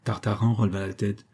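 ordinary delivery

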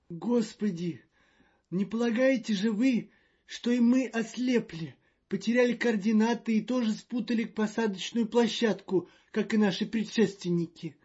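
high, loud and fretful; a jittery official in a panic; nervous diplomat voice. Acted. Magnan frets again.

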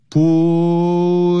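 "不" as a long, drawn-out "pweh"; Spanish letter sounds. Shown as a demonstration. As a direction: stretch "不" slowly into one held syllable, "pooo-eh". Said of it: "它是念成呃".

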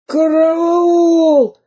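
very high squashed cry for help; strangled plea. A narrator's reading. Squashed spy Дырка begs for rescue, squealing. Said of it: "взмолился придушенный Дырка".

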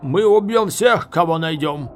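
loud, high menacing call; shouted threat. A narrator's reading. Enemy officer threatening those inside, shouting. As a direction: threaten them at full voice.

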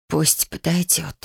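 slow, weary cracked voice; exhausted and slightly cracked. A narrator's reading. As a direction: force it out slowly, voice worn out. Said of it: "надтреснутым голосом выговорила Юми".

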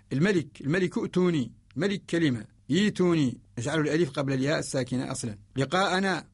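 usual speaking voice

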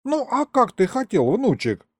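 put-on high-pitched old man's surprise; surprised grandpa. A narrator's reading. Grandpa to his grandson about the bank job, high and surprised. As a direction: sound amazed that it needs asking. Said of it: "удивился он".

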